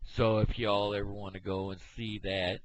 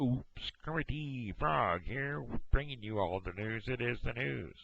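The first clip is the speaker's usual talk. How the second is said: Kermit the Frog; 6 semitones higher than usual. Retold or imitated. Frog puppet presents the news, higher and cheery. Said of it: "I can do Kermit the Frog".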